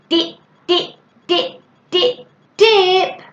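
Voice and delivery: "dip, dip, dip" high and rhythmic; repeated speech sound. Shown as a demonstration. Parent modelling a word for a child, repeated "dip". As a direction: say each "dip" separately, the last loud and high. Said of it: "more like speech sounds or speech words".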